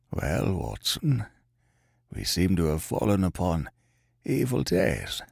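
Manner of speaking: low, soft sickbed voice; feeble voice. A narrator's reading. Sick man greeting his old friend, feeble. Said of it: "said he in a feeble voice".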